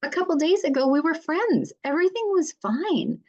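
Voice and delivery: high-pitched, acted-out bewilderment; bewildered. Client telling the mediator how lost they feel, bewildered. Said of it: "they are completely bewildered".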